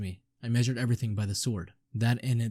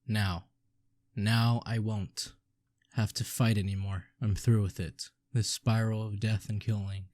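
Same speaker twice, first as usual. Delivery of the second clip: ordinary talk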